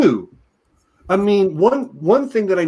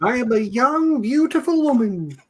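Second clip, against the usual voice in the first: acted sorceress quote; 6 semitones higher than usual. Acting out the sorceress's film line.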